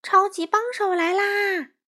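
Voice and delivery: high announcing cry; proud call. A narrator's reading. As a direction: herald his own arrival. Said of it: "他高声叫道".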